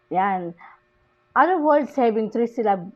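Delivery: usual speaking voice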